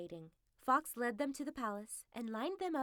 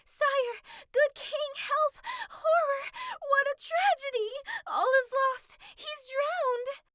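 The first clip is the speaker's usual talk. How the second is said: shrieked, much higher and louder; a fox shrieking false alarm to the king; screaming; 11 dB louder, slower than usual. Acted. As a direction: hysterical fake wail of disaster. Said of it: "Fox ran to the palace screaming".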